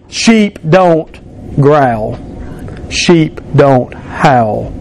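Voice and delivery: drawn out very slowly, word by word. Shown as a demonstration. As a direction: spell it out slowly for the congregation. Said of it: "you're supposed to judge and to say".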